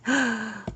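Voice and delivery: sharp gasp of surprise; surprised gasp. Real, not acted. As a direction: gasp at the news. Surprised by clay answer.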